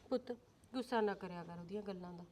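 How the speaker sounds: calm her down softly; soothing. Acted. Mother consoling the hurt daughter-in-law, soft and gentle.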